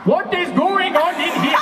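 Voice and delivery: high, slow, bad South African accent; badly faked South African, pitched high. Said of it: "South African accents, so bear with".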